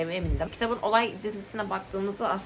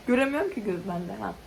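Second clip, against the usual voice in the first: usual speaking voice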